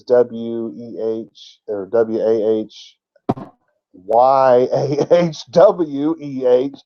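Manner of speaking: very slow letter-by-letter spelling; drawn-out, emphatic spelling aloud; deliberate spelling. Shown as a demonstration. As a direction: spell it out slowly, stretching each letter.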